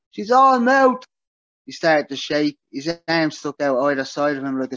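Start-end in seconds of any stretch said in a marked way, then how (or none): 0.1-1.0 roared, much higher and louder. A narrator's reading. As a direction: bellow it out at full force. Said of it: "he roared".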